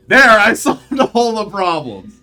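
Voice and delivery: slow, loud, laughing proud boast; smug laughing hero. Retold or imitated. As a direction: gloat through laughter like a movie hero. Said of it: "and it's just like".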